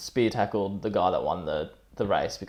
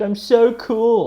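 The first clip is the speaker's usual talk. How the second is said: put-on much higher, louder and slow sing-song kid voice; drawn-out, much higher childish brag; mock kid's voice; 13 semitones higher, 9 dB louder, much slower than usual. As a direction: ham up a smug little kid. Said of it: "How he put on the kid's voice and he's like".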